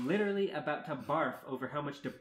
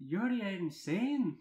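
high, drawn-out, loud outburst; fierce character voice, pitched up; 6 semitones higher, 5 dB louder, much slower than usual. A narrator's reading. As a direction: snap it fiercely, stretching the words high. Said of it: "said Merida in a very fierce tone".

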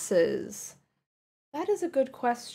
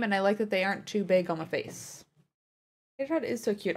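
normal talking voice

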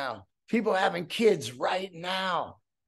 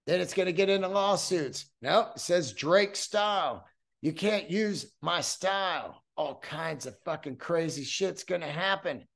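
ordinary talk

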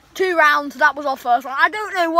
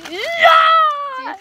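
excited shout; 12 semitones higher, 5 dB louder than usual. Real, not acted. Cheering a player's fail.

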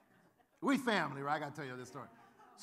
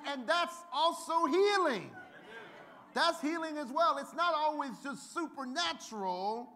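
loud, higher sermon shout, slowed; preaching at full force; 8 semitones higher, 8 dB louder than usual. Acted. Pastor preaching loud and high to the congregation. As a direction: drive the point home to the church.

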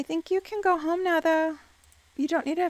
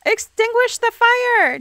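high, loud, slow command; frustrated player shouting at the screen; alarmed shout; 8 semitones higher, 7 dB louder than usual. Real, not acted. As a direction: yell it at the sim. Shouted order during the fire.

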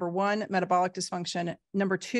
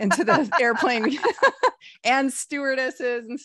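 laughing; 4 semitones higher, 4 dB louder than usual. Real, not acted. Laughing mid-sentence.

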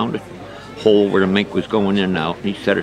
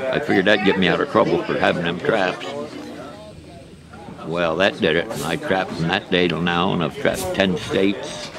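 ordinary talk, nothing marked

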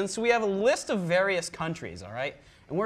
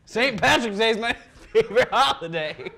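loud, higher Irish lilt breaking into laughter; mock Irish accent; 5 semitones higher, 7 dB louder than usual. Host trying Irish for the guest, laughing mock Irish. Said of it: "we're gonna move to Irish".

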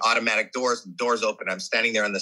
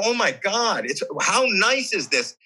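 delighted; 6 semitones higher than usual. Retold or imitated. Delighted reaction to fan letters.

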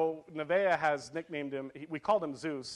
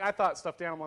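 usual speaking voice

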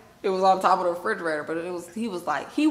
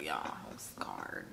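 low, breathless whisper; 16 dB quieter, slower than usual. Retold or imitated. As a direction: hush it like a confession. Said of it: "I was like".

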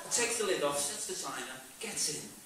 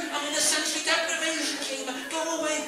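shouting; 8 semitones higher, 5 dB louder than usual. Retold or imitated. Yelling at woman in tank.

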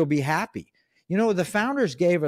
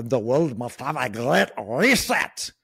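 put-on slow, deliberate German accent like a Bond villain; German-accented villain proclaiming a decree; Klaus Schwab German accent; 3 semitones lower, slower than usual. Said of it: "I'm sorry to impersonate his accent".

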